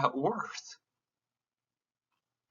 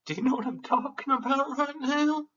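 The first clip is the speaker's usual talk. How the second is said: much higher, pleading and emotional; 11 semitones higher than usual. Real, not acted. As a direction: push it up high, near tears. Same question, now pitched high.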